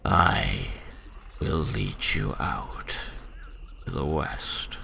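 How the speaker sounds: slow, somber orc voice with weighty pauses. Acted. Orc introducing himself as the guide out, somber. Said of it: "somber voice".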